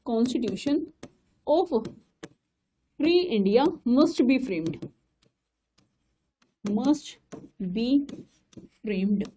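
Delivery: very slow, each word of "Constitution of Free India must be framed" set apart with pauses; word by word dictation. Shown as a demonstration. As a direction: dictate it so students can write. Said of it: "dictating word by word". (छात्रों को धीरे धीरे notes लिखवाना)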